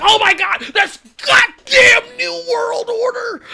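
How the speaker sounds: very high, hammed-up shriek; woman losing her mind. Imagined fan as Macho gets leg-dropped, losing her mind. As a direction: stretch it out in a shrill mock freak-out. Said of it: "I bet that bitch lost her mind".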